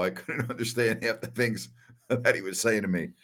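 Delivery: amused, laugh bubbling under the words; laughing while talking. Real, not acted. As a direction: chuckle through the memory. Laughing at his own family story.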